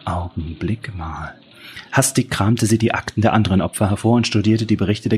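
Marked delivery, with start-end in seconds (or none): 0.1-1.3 slow whisper to herself; whispered realization. A narrator's reading. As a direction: breathe it as it dawns. Helen realises the pattern. (Flüstern, langsam)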